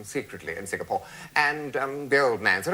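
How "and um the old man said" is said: normal talking voice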